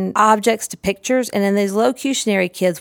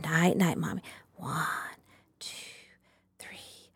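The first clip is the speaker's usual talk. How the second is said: hushed whisper, much softer; whispered shh; 13 dB quieter than usual. Shown as a demonstration. Putting the toy person to sleep, whispered. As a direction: breathe it out like a child is sleeping. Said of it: "when all the room gets quiet".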